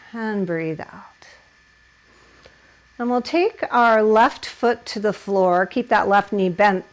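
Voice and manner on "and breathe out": whispered exhale cue; softly whispered guiding the breath out. Real, not acted. Yoga teacher cueing exhale.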